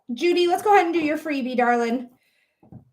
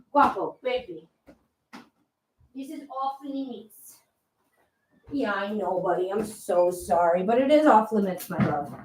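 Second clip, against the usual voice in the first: tender cooing; 5 semitones lower, 3 dB quieter than usual. Real, not acted. Cooing at the cat.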